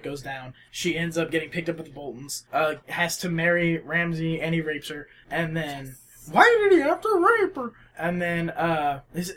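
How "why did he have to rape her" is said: high, fast indignant whine; mock outrage. Mocking the internet's complaint, high fast whine. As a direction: whine it like an upset commenter. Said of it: "mocking internet complaint".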